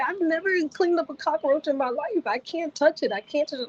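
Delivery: high-pitched mock-crying plea; tearful protest. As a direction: retell a panicked, crying refusal. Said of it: "I'm literally crying".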